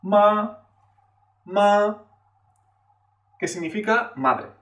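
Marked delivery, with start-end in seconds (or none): 0.0-2.0 "Ma" held high and level; high flat Mandarin first tone on "ma". Shown as a demonstration. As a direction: sustain "ma" on one high, even pitch. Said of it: "es el primer tono".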